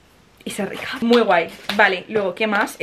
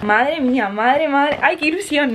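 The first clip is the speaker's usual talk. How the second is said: excited; 5 semitones higher, slower than usual. Real, not acted. Excited unboxing of gift package.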